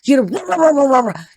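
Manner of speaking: high, rolling run of woofs; imitated yappy barking fit; mock dog barking. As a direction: bark wildly like a dog. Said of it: "if Tona was a barking dog".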